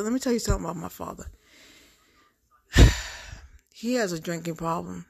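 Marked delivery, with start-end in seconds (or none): 2.7-3.3 loud weary sigh; heavy sigh. Real, not acted. Vlogger sighing heavily before talking about her father. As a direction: let out a heavy tired sigh.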